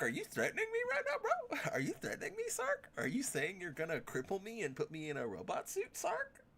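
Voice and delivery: high, fast incredulous challenge; Sark, are you threatening me, high and mocking; mock outraged squeak. Real, not acted.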